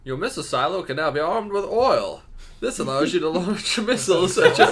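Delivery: high, loud, pompous narrator; hammed-up pompous announcer. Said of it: "this is the Pompous voice".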